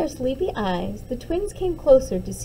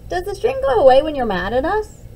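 put-on anxious child; 5 semitones higher, 7 dB louder, faster than usual. Child anxiously asks mom.

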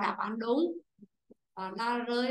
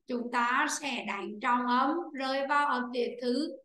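normal talking voice

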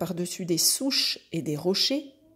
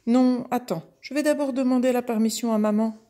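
high, loud and hurried calf voice; young calf character; 5 semitones higher, 5 dB louder, faster than usual. Acted. Calf stopping the kid to ask mummy first, urgent high voice. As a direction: blurt it fast as a little calf.

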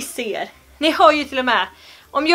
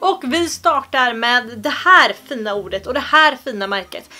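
usual speaking voice

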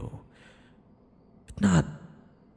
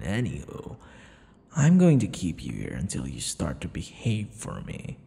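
ordinary talk

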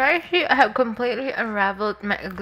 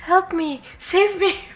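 mock plea; 9 semitones higher, slower than usual. Mock plea while hungry.

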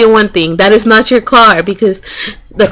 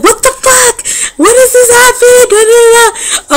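high, disbelieving squeal on the phone; shocked squeal; 13 semitones higher than usual. Retold or imitated. Telling her friends about the run-in, a high shocked squeal.

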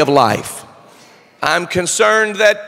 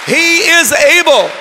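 raised, ringing sermon delivery; full-force preaching; 4 semitones higher, 3 dB louder than usual. Real, not acted. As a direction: proclaim it to the whole congregation.

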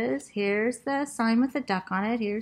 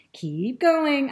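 urging; 6 semitones higher, 6 dB louder than usual. A narrator's reading. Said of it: "I urge them".

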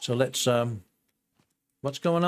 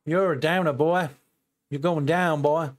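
put-on American Southern accent; 3 semitones higher than usual. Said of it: "That was my best American Southern accent".

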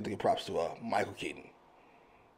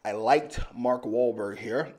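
"I liked Mark Wahlberg here" said slowly, every word crisp; clear careful retake; slower than usual. Shown as a demonstration. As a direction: fix the mumble, articulate slowly and clearly. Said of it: "I'm a mushmouth".

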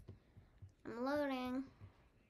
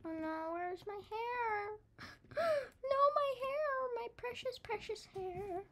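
high, whiny lament with a gasp; mock distress; 8 semitones higher than usual. Child gamer mourning the missing hair, mock distress. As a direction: wail for your lost treasure, hammed-up.

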